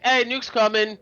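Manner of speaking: loud, high mock warning; shouted warning. Officials warning that nukes are coming, shouted warning. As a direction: call out a mock doomsday alert.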